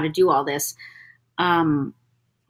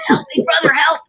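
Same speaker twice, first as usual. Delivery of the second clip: put-on higher pleading boy's cry; Mokuba voice; 7 semitones higher, 3 dB louder than usual. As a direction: call out like a frightened kid. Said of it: "he said".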